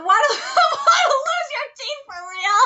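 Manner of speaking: high, loud, breaking into laughter; can barely get the quote out for laughing; laughing while quoting. Real, not acted. Viewer reading the sub line aloud, laughing hard.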